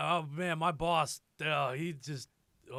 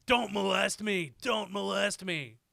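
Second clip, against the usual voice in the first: mock scream; 6 semitones higher, 7 dB louder, slower than usual. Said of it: "he just screams".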